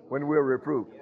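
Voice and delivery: ordinary talk, nothing marked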